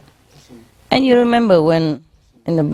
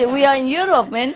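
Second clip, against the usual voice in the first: ordinary talk, nothing marked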